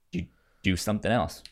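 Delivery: ordinary talk, nothing marked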